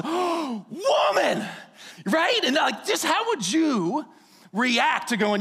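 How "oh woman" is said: high, breathless delight; delighted gasp. Retold or imitated. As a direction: swept off his feet at first sight. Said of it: "And he goes".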